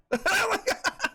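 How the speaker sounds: high, bright laugh bursting out; high burst of laughter. Real, not acted. Dad laughing at his son's grown-up reply, high laugh.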